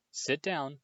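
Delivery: ordinary delivery, nothing marked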